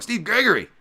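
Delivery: high, loud name shout; calling out. Real, not acted. Host hailing a viewer in the chat, high excited shout. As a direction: belt out the name in a sudden high greeting.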